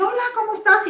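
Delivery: cheerful Juan greeting. Retold or imitated. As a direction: greet her like nothing is wrong. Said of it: "él me dice".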